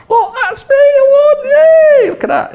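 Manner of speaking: squealing high and loud with laughter; excited showing off. Retold or imitated. Proud winner showing everyone his photo, squealing with delight. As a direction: crow with childish glee over the photo. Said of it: "I was showing people the photograph".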